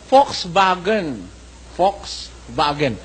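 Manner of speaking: Volkswagen said slowly as "Folksvagen", V like F and W like V; German accent on Volkswagen; German pronunciation. Shown as a demonstration. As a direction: give Volkswagen its German sound, F and V. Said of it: "ang pronunciation diyan ng mga mismong Aleman".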